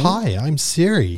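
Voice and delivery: Siri impression. Mimics the phone assistant's voice.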